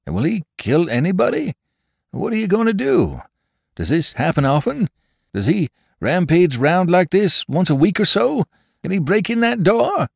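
very high, rapid-fire nervous questions; panicky drummer. Acted. Drummer fires anxious questions at the barkeeper, very high. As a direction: pile the questions up in rising panic.

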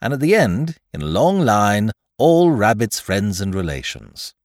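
plain reading voice

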